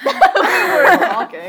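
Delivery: high, loud laughing. Real, not acted. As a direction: laugh while saying it. Laughing while interrupting.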